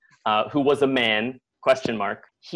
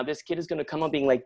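ordinary delivery

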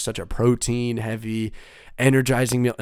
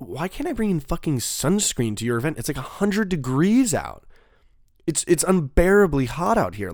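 higher-pitched, incredulous retold complaint, stretching the key words; indignant protest; 5 semitones higher, 3 dB louder than usual. Festivalgoer protesting to security about banned sunscreen, indignant. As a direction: argue the point in exasperated disbelief.

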